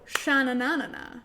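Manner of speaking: rhythmic frog chant; playful sha-na-na chant. Shown as a demonstration. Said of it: "just like this".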